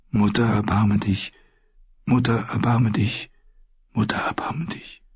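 quiet prayer sinking into a whisper; murmured plea dying away; prayer fading to whisper. Acted.

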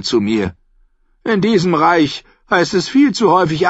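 high, loud voice of a mocking old noblewoman; a sly, tart old lady holding court; Olenna Tyrell. A narrator's reading. Olenna mocking a rival to Sansa, high and loud.

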